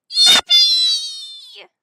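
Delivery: very high, loud, long cheer; gleeful squeal. Real, not acted. Streamer celebrating that the link sent, gleeful squeal. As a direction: squeal it out at full volume. Celebrates after pressing send.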